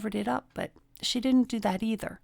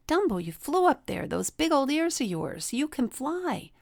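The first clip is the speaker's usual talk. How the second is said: higher, eager mouse voice, slowing at the end; Timothy the mouse; 6 semitones higher than usual. Retold or imitated. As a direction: cheer him on like a little mouse. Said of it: "And Timothy said".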